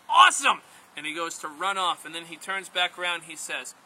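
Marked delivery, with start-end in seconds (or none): 0.1-0.6 high, loud whoop; thrilled. Acted. First man hears where grandmother lives, loud thrilled cry. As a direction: burst out with excitement.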